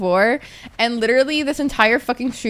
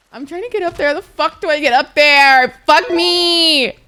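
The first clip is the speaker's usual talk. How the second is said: frustrated; 5 semitones higher, 7 dB louder than usual. Real, not acted. Frustrated climbing in game.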